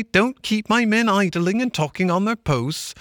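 high-pitched retelling voice; Mole's excited tale. Acted. Mole recounting the stoat sergeant, pitched high. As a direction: relish every word of the trick.

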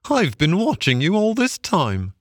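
high, mildly gasped mockery; gasping barge woman. A narrator's reading. Barge woman who watched Toad fail, high, mildly gasping. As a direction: lightly play her amusement in a high voice. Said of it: "she gasped".

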